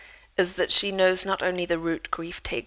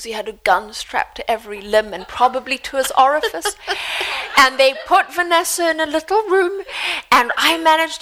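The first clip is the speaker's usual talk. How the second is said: higher, louder mock mother gushing through laughter; imitating Mum; 6 semitones higher, 6 dB louder than usual. Daughter imitating her mother telling the airport story, laughing. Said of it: "So my mother says".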